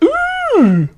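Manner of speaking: very high, put-on squeal of an ooh; LeFou impression. Reacting to co-host's TV show news, LeFou impression. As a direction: let out a cartoonish high-pitched coo. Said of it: "That was my LeFou impression".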